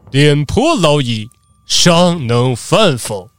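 slow, weighty pronouncement in a put-on voice; mock-solemn lecture, stretching the words; stern old samurai. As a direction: declaim it like a proud old warrior. Indignant samurai lectures family, quotes proverb.

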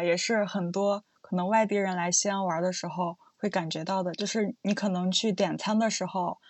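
ordinary talk

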